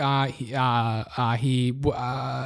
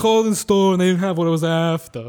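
put-on failed old Italian slipping into an action star; slurred movie tough-guy impression; Sylvester Stallone. Customer complaining about a clothing store, accidental Stallone impression. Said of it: "It's Sylvester Stallone".

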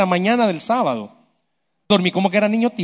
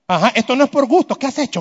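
quick, higher-pitched mock accusation; scolding wife; 5 semitones higher, faster than usual. Wife insisting her husband must have done something, mock scolding. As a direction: press him like a suspicious wife, imitated. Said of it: "me dijo".

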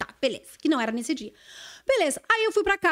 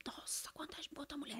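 unvoiced gossiping whisper; hushed secret aside; 25 dB quieter than usual. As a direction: hiss it behind a hand.

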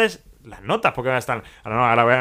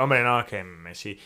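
mocking quote; 6 semitones lower, 6 dB quieter, slower than usual. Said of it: "me vengan a decir".

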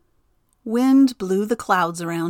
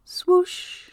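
wind whoosh; 11 semitones higher than usual. A narrator's reading. Narrator voices the wind.

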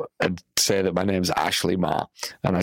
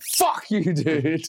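mock outrage; 7 semitones higher, much slower than usual. Outraged at Simon's fee.

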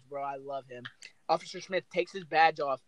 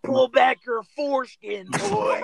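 mock cop order; 7 semitones higher, 5 dB louder, slower than usual. Mocking cop during a search.

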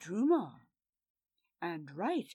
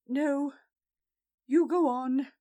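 louder, urgent plea to go on without her; urgent, raised urging; 5 semitones higher, 8 dB louder than usual. Acted. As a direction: push him onward, insistent. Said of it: "she urged Farewell".

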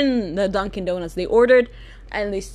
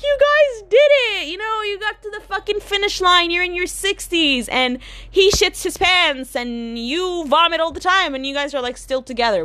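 mock cheering; 8 semitones higher than usual. Vlogger mock-cheering an old couple, high and sarcastic.

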